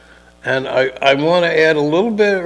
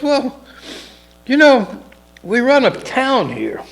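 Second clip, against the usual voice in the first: higher, louder mock mayor voice; a pushy mayor lording it, pitched up; smug mayor; 12 semitones higher, 5 dB louder than usual. Mayor leaning on the chief: mock smug politician voice.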